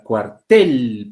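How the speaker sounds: "cuar-TEL", slow, hitting the end; stress on TEL. Shown as a demonstration. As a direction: punch and stretch the second syllable of "cuartel". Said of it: "tiene la mayor fuerza de voz en la segunda sílaba".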